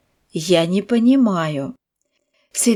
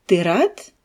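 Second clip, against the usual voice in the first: rising tune on рад; question intonation; 2 semitones lower than usual. Shown as a demonstration. Teacher to students, rising question tune. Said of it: "La voix monte sur le mot".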